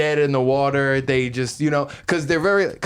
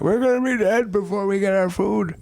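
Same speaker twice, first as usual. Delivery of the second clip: put-on complaining old men; 8 semitones higher than usual. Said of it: "kept hearing them complain".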